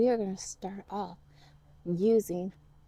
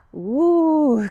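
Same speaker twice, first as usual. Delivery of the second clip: loud excited woo; 5 semitones higher, 8 dB louder than usual. Shown as a demonstration. Teacher whoops with the kids, excited. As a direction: let out a cheerful whoop. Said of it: "Make some noises with me".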